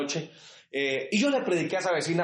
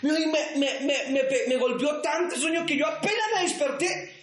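high, shouted pulpit preaching; preaching at full force; 10 semitones higher than usual. Acted. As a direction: build and shout it out to the church. (predicación a todo pulmón)

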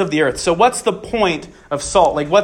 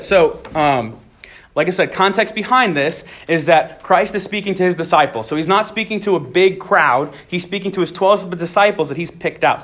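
ordinary delivery

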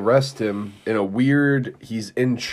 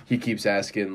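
ordinary talk

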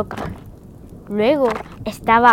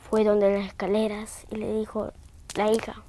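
plain reading voice

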